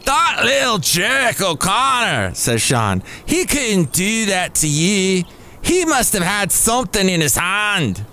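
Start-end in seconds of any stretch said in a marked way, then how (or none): 0.0-2.3 high, drawn-out Scottish bartender; put-on Scottish voice, pitched up; Scottish accent. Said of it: "I wanna go Scottish". 3.2-8.0 put-on Scottish voice, pitched up; Scottish accent. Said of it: "I wanna go Scottish".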